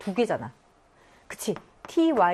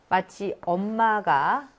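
ordinary talk

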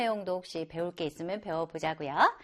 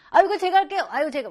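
higher, louder eager volunteering; eager offer; 7 semitones higher, 5 dB louder, much faster than usual. Acted. An outgoing person offering help, higher and louder. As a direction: jump in to help with gusto. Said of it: "막 이런 사람 있잖아요".

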